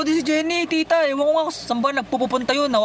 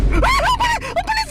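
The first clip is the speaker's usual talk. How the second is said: very high panicked shriek; panicked scream; slower than usual. Real, not acted. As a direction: scream as something rushes at you. Fleeing something fast in game. (natatarantang tili)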